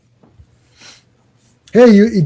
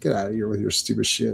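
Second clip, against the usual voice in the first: bored dismissal; 5 semitones lower, 10 dB quieter, faster than usual. Acted. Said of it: "'cause he's so bored".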